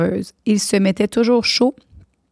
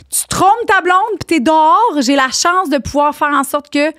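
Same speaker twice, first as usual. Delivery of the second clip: shouting at trespasser; 8 semitones higher, 4 dB louder than usual. Retold or imitated. Heated rant at a cheater.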